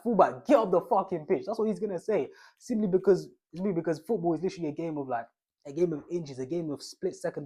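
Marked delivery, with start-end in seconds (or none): 0.0-1.4 loud, harsh telling-off; angry keeper. Pretend. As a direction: snap it like a furious keeper. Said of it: "He's gonna be like".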